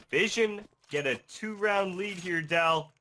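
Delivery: high, loud caster's shout; excited yelling. Real, not acted. Caster announcing Vision's two-round lead, excited yell. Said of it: "Don't yell at me".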